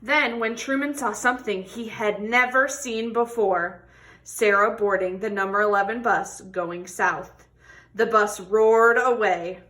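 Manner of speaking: expressive picture-book read-aloud; reading to children. Acted. Teacher reading a tortoise story to children, expressive. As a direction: tell the story warmly to a class.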